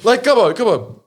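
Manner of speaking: high, loud, delighted exclaiming; thrilled and laughing at the punchline; real excitement. Real, not acted. Revels in own story's ending.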